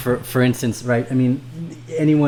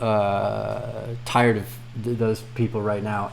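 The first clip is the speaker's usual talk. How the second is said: ordinary delivery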